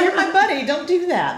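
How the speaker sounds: loud, high-pitched comic pleading; squealed objection to a buddy; mock protest. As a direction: cry out high and loud in playful horror. Acted protest to her friend.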